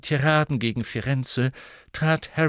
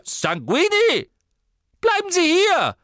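high, loud, sharp command; sudden stern call; 7 dB louder than usual. A narrator's reading. Warple ordering the vampire Sanguini to stay, stern shout. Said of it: "fügte Warple plötzlich streng hinzu".

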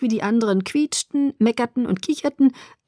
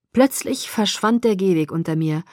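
ordinary delivery, nothing marked

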